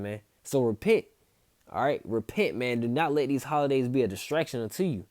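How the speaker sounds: usual speaking voice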